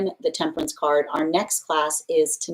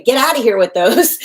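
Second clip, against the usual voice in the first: louder and higher, brushing the advice away; dismissive mock rejection; 5 semitones higher, 9 dB louder than usual. Ego refusing a partner's advice, higher mock brush-off. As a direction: swat the feedback off like a gnat. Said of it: "Earmuff energy".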